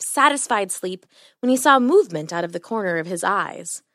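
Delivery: ordinary delivery, nothing marked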